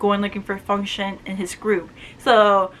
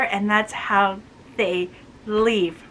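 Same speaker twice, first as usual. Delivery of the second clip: normal talking voice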